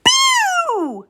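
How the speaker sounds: high squeaky mouse shout; a tiny mouse's loud squeak of a shout; shouted Boo. A narrator's reading. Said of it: "shouted a little gray mouse".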